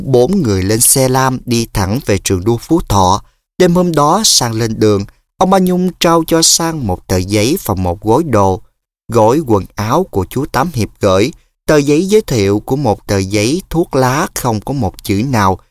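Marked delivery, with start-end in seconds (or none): none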